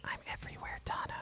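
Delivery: breathy ominous whisper; creepy whisper. Acted. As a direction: whisper it like a ghost. The AI threatens the players.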